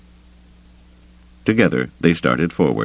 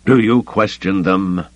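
slow challenge, higher in pitch; mildly dark challenge; 4 semitones higher than usual. A narrator's reading. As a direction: challenge them slowly, with dark restraint. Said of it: "Caboth said darkly".

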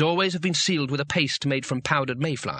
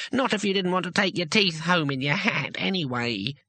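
higher-pitched gnome voice; hastily added; 4 semitones higher than usual. A narrator's reading. Gnome covering his remark about elves, hasty. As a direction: tack on a gnome's warning. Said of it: "he added hastily".

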